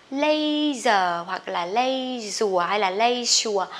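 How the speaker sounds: LEISURE misread as "lay-zer, lay-zua, lay-sua"; drawn-out wrong versions, LEISURE with an AY vowel; common mispronunciations. Shown as a demonstration. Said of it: "rất là nhiều bạn đọc là". (đọc sai leisure kéo dài thành "lây rùa, lây sùa")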